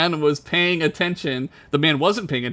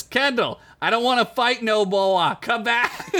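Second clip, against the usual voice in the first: higher pleading Boston voice; Boston boyfriend calling across, Boston accent; Boston man; 8 semitones higher than usual. Retold or imitated. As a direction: beg her back, Boston accent. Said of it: "and says".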